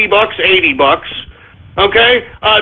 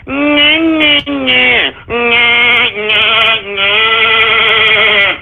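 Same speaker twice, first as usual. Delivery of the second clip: mocking taunt; much slower than usual. Real, not acted. Mocking the doubters.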